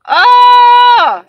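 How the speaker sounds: long, high, loud drawn oh; big drawn-out cry of frustration; loud exasperated oh. Real, not acted. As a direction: let out a big fed-up oh. Outburst of frustration.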